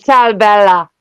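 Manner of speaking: imitating her Italian dad's accent with affection. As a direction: greet her like an Italian father would, in an Italian accent. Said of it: "He has the biggest Italian accent in the world".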